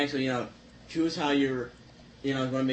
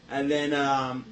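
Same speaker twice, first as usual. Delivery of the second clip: ordinary talk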